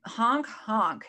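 loud goofy clown honk; silly clown. Pretend. Clown joke, honking back.

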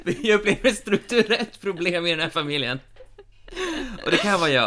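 talking through laughter; laughing. Real, not acted. Host jokes about a structural problem, laughing. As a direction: giggle through the joke.

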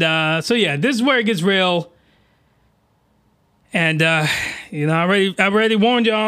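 normal talking voice

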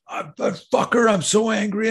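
high-pitched furious teenage outburst, retold. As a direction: act out a student blowing up in anger. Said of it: "he's furious about something".

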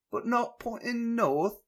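put-on corrected British accent. Steven remarks on the scarab, hammed-up British accent. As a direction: nail the English accent this time. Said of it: "There, that's better".

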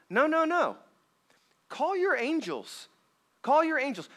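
high sly tempter voice; Satan. Retold or imitated. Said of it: "He's trying to say".

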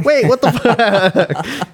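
slow, high, laughing "wait what"; stunned, laugh it out; shocked laughing disbelief. Retold or imitated. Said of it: "And we were like".

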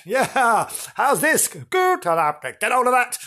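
high, loud, hammed-up ghost; taunting shadow man. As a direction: pester like a cocky spook. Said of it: "A shadow man rushing about".

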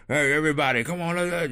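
loud, high barking foreman calling the crew; bossy plant manager. Retold or imitated. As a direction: holler orders across the floor like a boss. Boss directing workers at plant.

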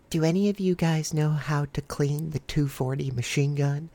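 soft, hushed question to the group; a soft mystery voice calling out; voice from the dark. Retold or imitated. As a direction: quietly ask around in the dark. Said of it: "we just hear this voice".

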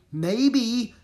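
high, loud reply; yelling. A narrator's reading. Jack answering Annie over wind, yelling. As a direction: yell back over the storm. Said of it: "yelled Jack".

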